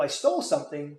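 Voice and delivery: ordinary talk, nothing marked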